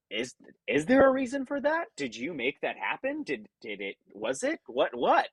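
puzzled viewer, pitch leaping high; high-pitched, halting mock bewilderment; questioning audience member. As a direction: act out baffled questions, stumbling and breaking off. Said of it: "you as an audience member would say".

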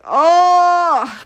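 long, high groaned "oh"; retold groan. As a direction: act out a drawn-out reaction groan. Groaning reaction to dad's remark.

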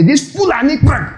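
high-pitched acted outburst; mock outburst. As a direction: blurt it out high, acting the offender. Acts out someone insulting, then cut off.

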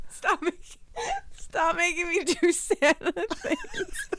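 laughing through the words, slow and high; giggling helplessly while protesting; hiccup giggles. Real, not acted. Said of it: "I can't stop laughing now".

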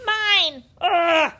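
put-on high, very loud childish wail; tantrum wail. A narrator's reading. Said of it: "she wailed".

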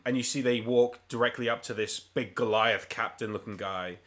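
ordinary delivery

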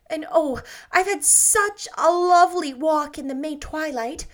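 put-on bright, breathless girlish rapture; Anne's voice. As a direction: gush like a dreamy, happy girl. Anne voiced, girlish, higher.

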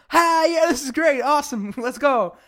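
high, loud burst of mock excitement; Connie, overexcited. Co-host acts out Connie thrilled with her card, overexcited.

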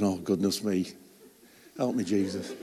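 a half-laughed prayer under the breath; mock dread. To Jesus while gutting the turkey, hushed mock dread.

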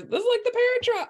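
imitating her cohost. Teasing her cohost watching the movie, high giddy mock squeal. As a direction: squeak it out like an overexcited fan. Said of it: "You're like".

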